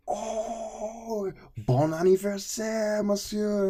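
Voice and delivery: high, loud, drawn-out French well-wishing; super excited professor. Retold or imitated. Professor wishing student happy birthday, imitated super excited gushing. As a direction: gush it slowly in imitated French delight. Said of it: "He was just super excited about it".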